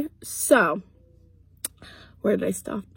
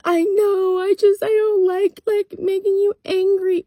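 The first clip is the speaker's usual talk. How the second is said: much higher, whiny imitation of the girlfriend; pleading girlfriend; 9 semitones higher, 3 dB louder, slower than usual. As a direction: mimic her pleading, pitched way up. Girlfriend pleading, voiced in story.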